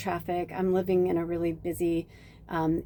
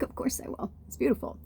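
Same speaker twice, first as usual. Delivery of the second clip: soft whisper of a promise; hushed, imitating own promise; whispered reassurance; 5 semitones higher, 7 dB quieter than usual. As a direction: breathe it gently like a secret.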